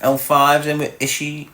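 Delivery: ordinary talk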